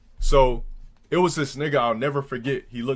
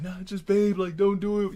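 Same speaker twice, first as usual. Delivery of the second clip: higher-pitched pleading girlfriend imitation; 8 semitones higher than usual. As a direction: mimic a girlfriend talking you out of it. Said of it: "My girl's like".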